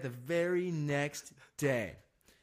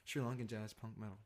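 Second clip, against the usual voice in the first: quiet deadpan "Sri Lankan jazz punk metal", dropped softer; straight face; 5 semitones lower, 9 dB quieter than usual. Pretend. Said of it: "I'm just keeping a straight face".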